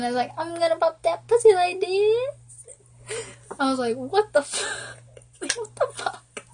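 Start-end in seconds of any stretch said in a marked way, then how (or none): 0.4-2.3 high-pitched imitation, rising to the end; mock yelling. Girlfriend mimicking boyfriend's yelled song, high mock yell. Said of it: "He kept yelling". 4.0-6.4 very high, incredulous squeak; mock disbelief. Girlfriend reacting to boyfriend's crude song, incredulous.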